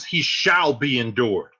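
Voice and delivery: loud, slowed, commanding; angry Capulet. Acted. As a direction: uncle overruling his nephew. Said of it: "Capulet says".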